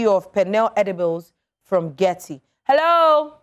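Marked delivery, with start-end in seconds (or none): 2.6-3.3 calling out. Real, not acted. Host calling silent caller.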